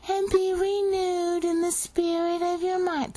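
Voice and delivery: mocking high reading of the bad translation; mocking quote. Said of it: "That's not what it says".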